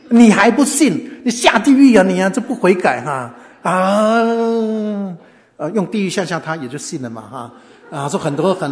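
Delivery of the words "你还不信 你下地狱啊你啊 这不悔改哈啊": loud, high scolding with long drawn-out final cry; mock hellfire threat. Prison evangelist frightening inmates, hammed-up hellfire threat. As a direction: thunder damnation, stretching the last cry.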